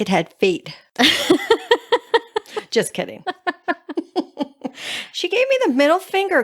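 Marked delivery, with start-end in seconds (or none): 1.0-2.6 high burst of laughter; real high-pitched cackle of amusement. Real, not acted. Teasing the co-host about the booger. 3.3-5.1 high, softer laughing; real softer high giggle; laughter. Real, not acted. Laughing after "just kidding.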